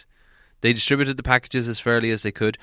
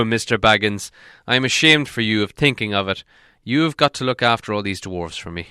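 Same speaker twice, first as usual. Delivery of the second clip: Gandalf's voice; 4 semitones higher, 6 dB louder than usual. A narrator's reading. Gandalf scolds Bilbo.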